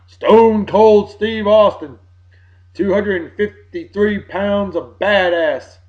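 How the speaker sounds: slow, loud drawn-out wrestler introduction; ring announcer intro. Retold or imitated. Introducing Stone Cold to his friend, slow announcer hype. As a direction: announce him like he's walking to the ring.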